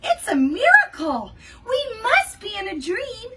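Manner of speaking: loud, high astonished shout; amazed shout. Acted. Animals watching Gerald dance, loud amazed shout. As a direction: cry out in wonder. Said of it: "They shouted".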